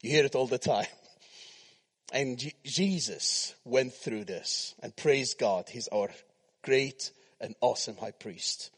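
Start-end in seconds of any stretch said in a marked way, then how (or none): none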